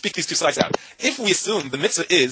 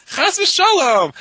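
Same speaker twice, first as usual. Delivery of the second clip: chas v'shalom; 7 dB louder, much slower than usual. Retold or imitated. Rosh yeshiva's rereading of Mishnah.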